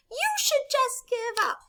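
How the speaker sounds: very high, slow bird voice; squeaky storybook taunt; mocking little birds. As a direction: jeer like a tiny bird. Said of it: "the birds again laugh".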